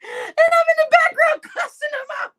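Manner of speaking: high, loud laughing narration; loud amused telling; excited shout. Real, not acted. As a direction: blurt it out cracking up.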